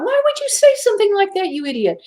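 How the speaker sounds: very high, fast squeaky rebuke; acted-out exasperated self-scolding; scolding herself. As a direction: berate yourself in a rapid high voice. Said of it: "I'm having this inner struggle".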